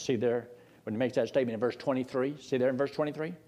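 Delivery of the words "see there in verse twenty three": ordinary delivery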